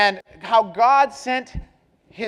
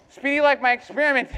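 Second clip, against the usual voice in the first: ordinary talk, nothing marked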